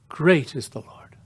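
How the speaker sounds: whispered reverently, no voice. Shown as a demonstration. Preacher repeating the psalm to the congregation in a whisper. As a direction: breathe "Great is the Lord" as a hushed whisper.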